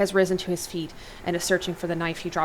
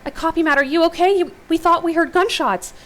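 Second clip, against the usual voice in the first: worried; 10 semitones higher, 7 dB louder than usual. A narrator's reading. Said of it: "Angela's voice sounds worried".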